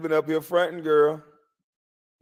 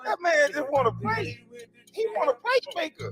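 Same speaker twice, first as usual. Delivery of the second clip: mock scolding; 12 semitones higher than usual. Scolding voice continues, calmer.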